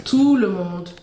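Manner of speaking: «Tout le monde» appuyé, plus fort, «Tout» allongé; modèle à répéter. Shown as a demonstration. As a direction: model «Tout le monde» firmly for a child to repeat. Fait répéter l'enfant après elle. («Tout le monde» bien articulé pour faire répéter)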